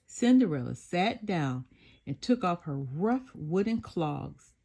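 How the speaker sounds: ordinary delivery, nothing marked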